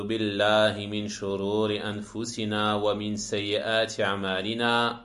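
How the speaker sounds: measured, chant-like opening invocation; formal Arabic recitation. Acted. Lecturer opens the lesson with praise of God, formal recitation. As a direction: intone it evenly, stretching the long vowels.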